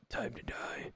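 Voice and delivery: hushed, menacing whisper of a lurking strangler; creepy whisper. Retold or imitated. Streamer imagines a hoodie arm strangling him, creepy whisper. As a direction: breathe it like a horror villain, barely voiced. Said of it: "just strangle and be like".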